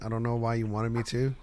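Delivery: normal talking voice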